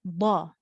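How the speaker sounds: ba said with lips rounded in a circle, a dark heavy D. Shown as a demonstration. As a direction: round your mouth into a circle for ba. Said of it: "put your mouth in a circle and say ba".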